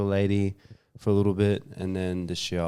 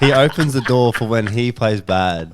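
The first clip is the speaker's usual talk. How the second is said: talking through laughter, louder; laughing; 3 semitones higher, 9 dB louder than usual. Real, not acted. Mate teasing his friend about bad rounds, laughing loudly.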